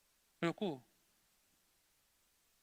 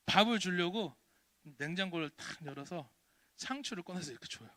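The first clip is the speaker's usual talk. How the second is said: normal talking voice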